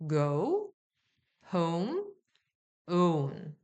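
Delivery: go를 고우처럼 미끄러지게; home은 호움으로 끝을 우로; ou diphthong. Shown as a demonstration. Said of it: "이렇게 가시면 돼요". (오우 이중모음 시범)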